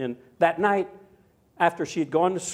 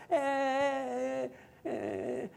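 put-on toddler whine; 9 semitones higher, 8 dB quieter than usual. Toddler whining on the sofa.

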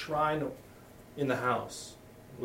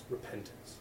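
whispered word; 5 semitones lower, 14 dB quieter than usual. Acted. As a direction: breathe out "Repentance" as a hushed whisper. Preacher whispers key word.

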